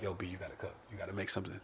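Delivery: hushed urgent whisper. Retold or imitated. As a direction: whisper it so nobody hears. Asking brother quietly.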